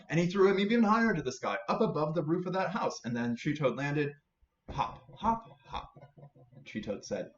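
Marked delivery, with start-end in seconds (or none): 4.7-5.9 hopping sounds. Acted. Toad landing.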